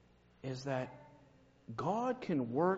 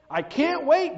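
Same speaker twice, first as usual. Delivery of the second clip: gasped excitement; 12 semitones higher, 9 dB louder than usual. Acted. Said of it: "gasped Sam".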